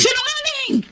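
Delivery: high furious shriek; accusing cry. Acted. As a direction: hurl the accusation. Wife accuses husband. (galit na sigaw)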